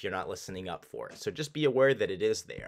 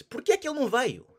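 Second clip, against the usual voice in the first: quick, run together, pitched higher; fast native speed; 5 dB louder, much faster than usual. Shown as a demonstration. Teacher showing how natives really say it, fast and run together. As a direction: rattle it off like a native. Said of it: "The way you're gonna hear that is".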